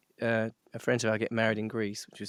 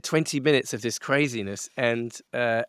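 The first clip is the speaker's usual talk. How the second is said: ordinary delivery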